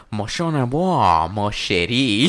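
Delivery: slow, drawn-out mock French lover voice. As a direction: croon it like a cheesy French lover. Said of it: "he goes like this".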